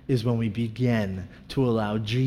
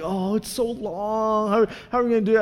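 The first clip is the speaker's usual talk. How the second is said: wife's emotional whine; 8 semitones higher than usual. Retold or imitated. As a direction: mimic the wife fretting about the long separation. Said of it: "she would always get emotional".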